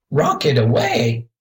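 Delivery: lightly squawked bird call; a mild, narrated parakeet squawk. A narrator's reading. Budgie studying the children calls out, with a light squawk. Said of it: "Then he squawked again".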